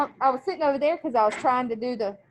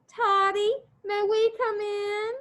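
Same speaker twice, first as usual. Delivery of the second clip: higher, slow, syrupy sweet call; sugary mother; 8 semitones higher, much slower than usual. Acted. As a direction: coo at her boy through the door. Mother sweetly calls her son.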